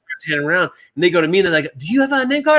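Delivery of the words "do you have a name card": high-pitched eager question; mock-polite high asking voice; eager questioner. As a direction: imitate a keen contact, voice pitched way up. Quoting contacts asking him again.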